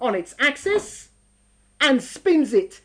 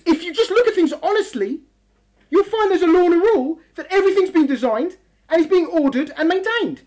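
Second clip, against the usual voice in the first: ordinary delivery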